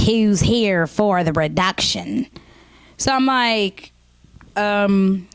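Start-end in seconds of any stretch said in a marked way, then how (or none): 0.1-2.3 put-on slow, sour old-lady question; sour receptionist. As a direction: ask it slowly, like she sucks lemons. Sour receptionist asks obvious question.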